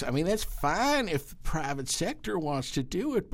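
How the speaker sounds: high, rising cranky congressman voice; Ron Paul. Acted. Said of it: "Can I do a little Ron Paul?".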